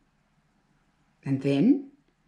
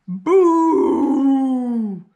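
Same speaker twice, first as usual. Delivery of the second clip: blaring tuba boo; 5 semitones higher, 7 dB louder than usual. A narrator's reading. Bunyip playing Emily's tuba, loud blaring boo. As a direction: blast it out like a brass horn. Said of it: "blared the tuba".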